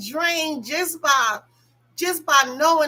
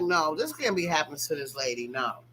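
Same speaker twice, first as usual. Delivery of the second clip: lower, softer, dropping away; heartbroken; 8 semitones lower, 5 dB quieter than usual. Retold or imitated. Upset for Sheree, a low heartbroken no. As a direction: let it sink in disbelief. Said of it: "I'm like".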